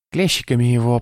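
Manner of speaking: high-pitched, eager urging; getting excited. A narrator's reading. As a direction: egg the torturer on. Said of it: "возбуждаясь". (возбуждённо)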